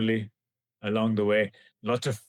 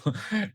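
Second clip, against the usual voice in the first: rueful laugh; 11 semitones higher than usual. Real, not acted. Rueful laugh at irreversible mistake.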